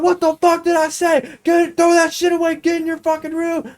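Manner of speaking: high-pitched imitation of angry mom; mad hollering. Mom sending her prankster son to his room, mad hollering. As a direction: scold and order him off, high-pitched. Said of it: "she's mad as fuck".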